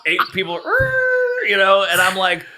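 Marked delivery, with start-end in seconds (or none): 0.6-1.5 engine noise. Retold or imitated. Imitating car swerving again.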